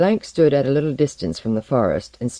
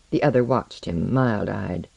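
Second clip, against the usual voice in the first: ordinary delivery, nothing marked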